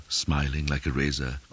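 ordinary delivery, nothing marked

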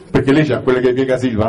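normal talking voice

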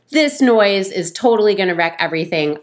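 ordinary talk, nothing marked

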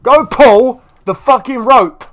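high, loud, slow furious command; real frustration, bellowed slowly; angry order. Real, not acted. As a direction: snap the order at a stubborn pet. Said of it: "I'm getting a bit pissed off now".

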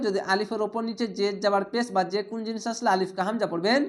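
normal talking voice

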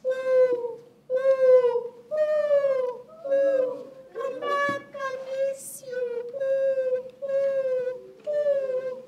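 high, calling owl hoots. Acted. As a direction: call across the yard like an owl. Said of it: "and he went".

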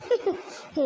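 short scornful laugh before answering; a bitter laugh at his promise. Acted. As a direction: scoff at his plea with one dry laugh. Wife scoffs at his plea.

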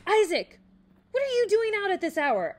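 high, loud scolding yell; angry shout. A narrator's reading. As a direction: shout at him, furious and worried. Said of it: "shouted out in anger".

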